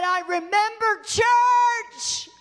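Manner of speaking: high, drawn-out preacher's shout; impassioned cry. Retold or imitated. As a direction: cry out the quote, stretching the last word. Psalmist's line cried out in sermon.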